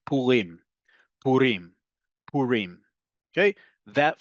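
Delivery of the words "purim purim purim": Purim repeated, weight on rim each time; Purim modelled, stress on the end; stress on the last syllable. Shown as a demonstration. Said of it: "emphasis on the last syllable".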